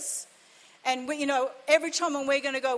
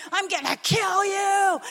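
slow, higher, louder mock threat; retold street threat, shouted and slow; imitating angry threat; 5 semitones higher, 3 dB louder, slower than usual. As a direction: growl out the man's rage, hammed-up. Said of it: "swearing his head off at him".

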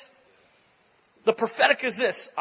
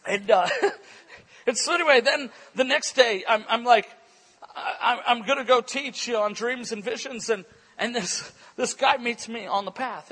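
ordinary delivery

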